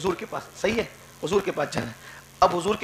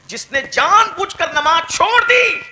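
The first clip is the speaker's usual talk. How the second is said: stern cry; 10 semitones higher, 7 dB louder, slower than usual. Retold or imitated. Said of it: "آقا فرما رہے ہیں".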